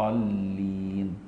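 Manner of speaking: الضالين chanted slow and soft with a proper ض; correct dad. Shown as a demonstration. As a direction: intone الضالين gently, true ض. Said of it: "هي كده".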